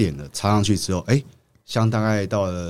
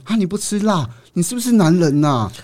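mocking taunt; 9 semitones higher, 5 dB louder than usual.

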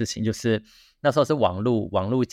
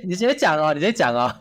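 ordinary delivery

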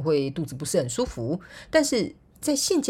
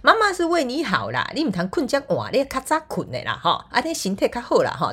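high, loud motherly nagging; nagging mom lecture; 8 semitones higher, 4 dB louder than usual. Retold or imitated. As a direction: fuss over bedtime like mom. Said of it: "妈妈就会跟你讲说".

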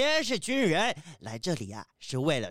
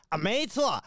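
usual speaking voice

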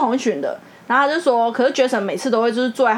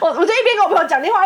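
usual speaking voice

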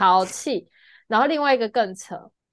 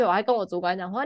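usual speaking voice

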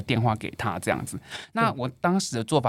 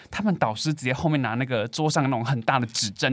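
ordinary talk, nothing marked

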